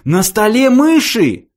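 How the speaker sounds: loud, high, drawn-out yell; sudden yell. A narrator's reading. Arthur suddenly yelling at the mice on the table. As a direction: blurt it out in a shocked high yell. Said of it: "внезапно завопил".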